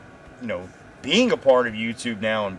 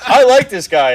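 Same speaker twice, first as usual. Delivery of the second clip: amused; 12 semitones higher, 14 dB louder than usual. Retold or imitated. Amused retold reaction to greeting.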